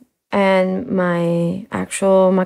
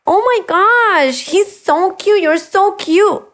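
high, slow cooing voice; cooing baby talk; 11 semitones higher, slower than usual. Retold or imitated. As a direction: gush over a small child. Cooing at supposed child.

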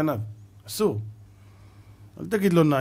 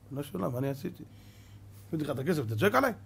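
puzzled reply; 5 semitones lower, 9 dB quieter, faster than usual. Acted. Other friend answers, puzzled.

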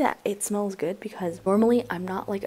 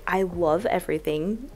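ordinary talk, nothing marked

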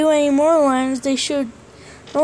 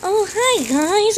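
higher bright puppet hello; high puppet greeting; 7 semitones higher, slower than usual. Pretend. Bon Bon greets viewers.

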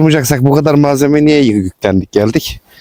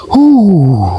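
loud shivering huu, pitched high; cold shudder; 8 semitones higher, 3 dB louder than usual. Real, not acted. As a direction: let out a freezing cold howl. Reacting to freezing cold. (soğuktan yüksek sesle huu)

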